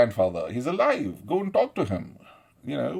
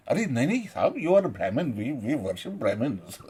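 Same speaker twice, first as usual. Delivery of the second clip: suddenly grovel to someone important; hammed-up obsequious reassurance; mock deference; much faster than usual. Mimics bullies turning deferential.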